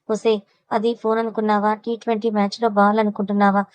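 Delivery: ordinary delivery, nothing marked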